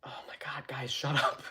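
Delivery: hushed, breathy plea to stop arguing; aunt whispering. Retold or imitated. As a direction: whisper it like an aunt fed up with the fighting. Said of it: "my aunt is just sitting in the corner like".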